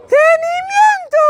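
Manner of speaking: Tenimiento in a high squeaky voice, loud; high squeaky drill. Shown as a demonstration. Teacher drilling the class, squeaky high voice. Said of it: "drill in high squeaky voice".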